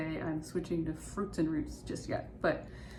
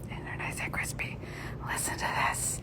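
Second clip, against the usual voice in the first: whispering. Real, not acted. Late-night snacker shows off crispy potato rounds, whispering. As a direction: whisper it close to the listener. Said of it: "I don't know why I'm whispering".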